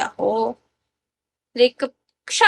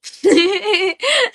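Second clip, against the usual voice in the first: giggle; 7 semitones higher than usual. Real, not acted. Laughs at chat comment.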